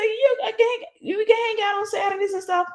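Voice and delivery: high, different voice for the friend; friend's voice. Retold or imitated. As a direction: play the friend agreeing. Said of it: "She said".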